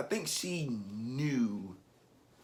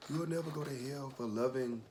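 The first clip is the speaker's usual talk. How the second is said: soft, higher, choked-up reassurance; near tears; 4 semitones higher, 4 dB quieter than usual. Real, not acted. Recalling grandmother's blessing to grandchild, near tears. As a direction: reassure tenderly while fighting real tears. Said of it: "I'm about to start crying".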